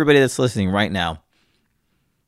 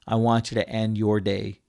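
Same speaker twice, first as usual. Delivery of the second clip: normal talking voice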